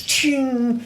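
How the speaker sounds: high sharp clang effect; metallic ching sound. Acted. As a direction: ring out like steel on steel. Sound effect of parried axe.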